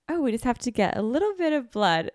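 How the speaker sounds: a hint of a chirpy nurse; lightly voiced casual nurse; breezy nurse. Nurse casually explains the blood test, lightly mimicked.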